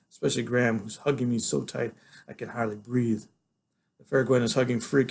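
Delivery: ordinary delivery, nothing marked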